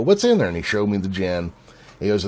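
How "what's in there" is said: higher, louder, eager question; leaning in to ask about the drink; curious question. Retold or imitated. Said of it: "I go".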